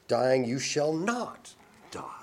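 slow hushed whisper, weight on not; serpent's whisper. Acted. Preacher voicing the serpent contradicting God, whispered. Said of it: "the serpent, said".

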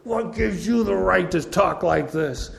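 challenging stranger. Retold or imitated. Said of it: "this guy comes up and goes".

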